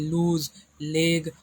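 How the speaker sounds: "लूज, लेग" said very slowly, each word stretched so the L sound is clear; example words, drawn out. Shown as a demonstration. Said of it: "आप ले बोल सकते हैं".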